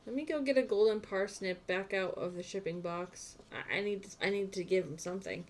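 ordinary talk, nothing marked

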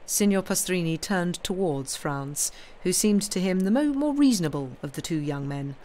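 usual speaking voice

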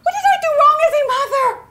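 put-on a mother wailing at her child; anguished high scolding. Mother wails at daughter caught partying, shrill and tearful.

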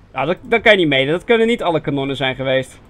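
normal talking voice

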